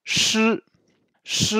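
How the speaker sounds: "ch" held high and level, closed e; high, sustained "ch" with a tight little vowel; model pronunciation. Shown as a demonstration. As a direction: say "ch" as shi for learners to repeat. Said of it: "Donc là, répétez".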